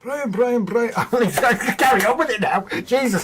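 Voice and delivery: high, loud, laughing exasperation; real laughter breaking into high-pitched pleading; laughing protest. Real, not acted. Cracking up after accent attempt.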